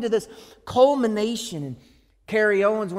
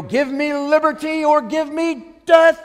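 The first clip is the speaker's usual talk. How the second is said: defiant, slow and loud; mock heroic defiance; bold Patrick Henry; 6 semitones higher, 7 dB louder, slower than usual. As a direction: declare it like a patriot. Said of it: "like you might imagine Patrick Henry".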